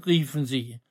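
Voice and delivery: ordinary delivery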